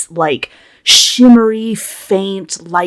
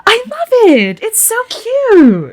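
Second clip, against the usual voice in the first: delighted Christine; 5 dB louder than usual. Acted. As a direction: gush over her friend's flapper dress. Christine admires Meg's dress.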